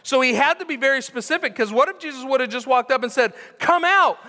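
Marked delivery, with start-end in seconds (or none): 3.6-4.2 high, loud shout across a graveyard; loud call. Acted. Jesus calls to the whole graveyard, loud shout. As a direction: bellow it to every tomb.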